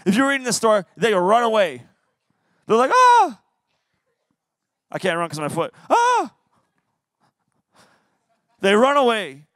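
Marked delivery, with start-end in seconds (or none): 2.9-3.4 high, loud comic shriek; hammed-up panicked "ah"; mock terrified scream. Israelites running away terrified. 5.9-6.3 very high comic shriek; hammed-up high "ah"; mock terrified scream. Repeats the fleeing Israelites' scream.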